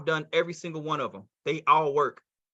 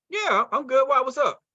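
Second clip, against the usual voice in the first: lightly voiced prospect, pitched higher; quick, higher-pitched 'what's up' reply; prospect's reply; 8 semitones higher than usual. Acts prospect replying to caller.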